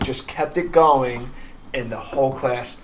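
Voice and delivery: ordinary delivery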